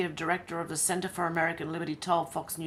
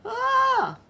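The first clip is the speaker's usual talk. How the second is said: shocked yelp; 7 dB louder than usual. Real, not acted. Shocked reaction to news story.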